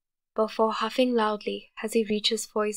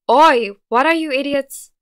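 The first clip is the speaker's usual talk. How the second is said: loud, higher annoyed bark; angry bark; 4 semitones higher, 11 dB louder than usual. A narrator's reading. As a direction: snap at the idiots who woke him. Woken Bakugo barks at intruders.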